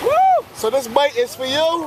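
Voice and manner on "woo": excited whoop. Real, not acted. Tasting the fish.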